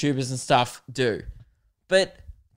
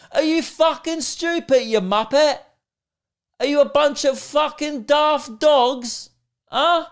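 much higher and louder British tirade; angry British accent; 9 semitones higher, 3 dB louder than usual. Pretend. Ranting at the repeating journalists in a furious British accent. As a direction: berate them in a mock British accent. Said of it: "Apparently I turn British where I get mad".

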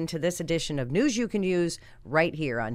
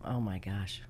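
breathless whispered awe; awed whisper; 9 semitones lower, 7 dB quieter, slower than usual. Real, not acted. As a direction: whisper it, deeply moved. Moved by guest's words.

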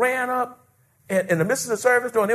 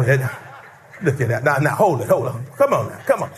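ordinary talk

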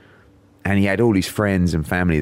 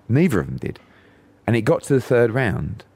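ordinary delivery

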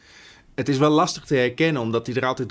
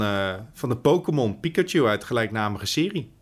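ordinary talk

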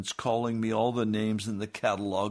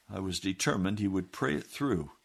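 ordinary delivery

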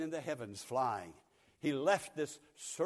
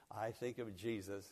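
ordinary talk, nothing marked

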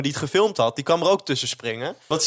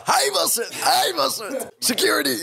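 accusing cry pitched way up, volume held back; retold screaming; 11 semitones higher than usual. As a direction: imitate yourself crying out to security. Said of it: "Dus ik begin te schreeuwen".

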